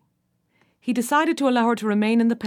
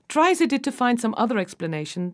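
ordinary delivery, nothing marked

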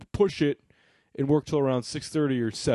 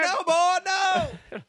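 mock panic; 3 dB louder, much slower than usual.

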